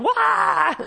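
sudden high, loud "wah"; mock blast cry. Pastor imitating firing shots at the congregation, mock blast cry. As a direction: burst out in a comic yell.

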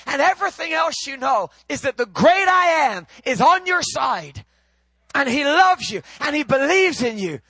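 loud, high, ringing pulpit delivery; fired-up preacher proclaiming God's love; full-force preaching. Acted. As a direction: preach it out to the whole room, climbing.

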